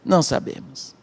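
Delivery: low, soft, falling reply; subdued answer. Retold or imitated. Disciples admitting ignorance to Jesus, subdued answer. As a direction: drop the voice right down.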